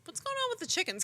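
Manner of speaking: high, puzzled question; bewildered. Retold or imitated. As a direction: ask it up high, baffled. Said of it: "I'm like".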